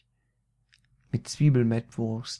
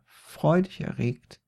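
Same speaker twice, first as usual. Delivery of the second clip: ordinary delivery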